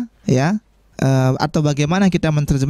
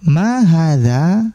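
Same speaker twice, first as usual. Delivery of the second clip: slow model reading; much slower than usual. Shown as a demonstration. Teacher models Arabic question slowly.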